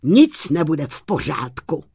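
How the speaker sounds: loud, irritated retort; acted indignation, punched at the start; annoyed outburst. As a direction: cut the excuses short, crossly and loud. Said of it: "rozčílil se Bartoloměj".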